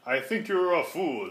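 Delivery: ordinary delivery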